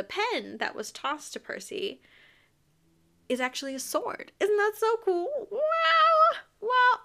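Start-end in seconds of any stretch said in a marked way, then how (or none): 4.4-7.0 very high, loud squealed wow; hammed-up amazement; over-the-top awe. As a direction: gush in a high, stretched voice. Gushing over the pen sword.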